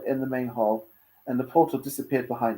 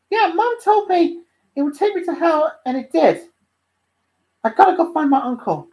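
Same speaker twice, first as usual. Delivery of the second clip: high, loud character voice, excited; eager young Dante; 7 dB louder than usual. Acted. Dante explaining the amulet to Luna, high and eager. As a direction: pitch it well up, loud and keen.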